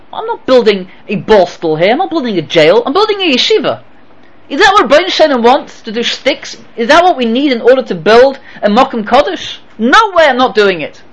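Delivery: indignant. Retold or imitated. Rabbi quotes his own refusal.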